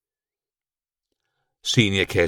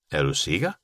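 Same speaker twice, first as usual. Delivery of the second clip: hesitant; 3 semitones lower than usual. A narrator's reading. Boy checking with his bat, hesitant. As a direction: ask cautiously, rising at the end. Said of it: "spurgte Eddie tøvende".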